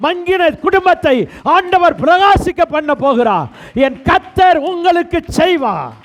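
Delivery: rising, urgent sermon cadence; fervent preaching. Acted. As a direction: proclaim it like a revival preacher. Preaching at full force.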